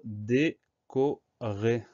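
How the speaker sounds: "décorer" very slow, a gap between syllables. Shown as a demonstration. Teacher sounding out décorer for spelling, syllable by syllable. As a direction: stretch "décorer" out as dé, co, rer. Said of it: "première syllabe D".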